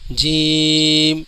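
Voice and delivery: جیم slowly, long ee closing on m; final m sounded. Shown as a demonstration. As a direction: stretch جیم and land on the m. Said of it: "اس میں میم کی آواز آخر میں ادا ہوتی ہے".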